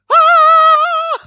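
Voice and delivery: sudden very high loud sung "Ah!"; high note cry. Retold or imitated. Revolutionaries ending meeting on a high note, very high loud "Ah!". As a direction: hit a high note all together. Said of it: "And everyone goes".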